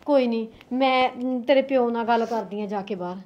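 mother gently comforting her crying daughter; soft comforting. Acted. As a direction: soothe her to lie down.

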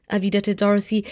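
ordinary delivery

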